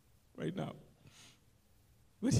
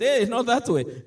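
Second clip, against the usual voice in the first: louder, rebuking "hey, not that way"; firm rebuke; 4 semitones higher, 9 dB louder than usual. Retold or imitated. Jesus stopping Peter after the ear cut, firm loud rebuke. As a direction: stop him firmly mid-act.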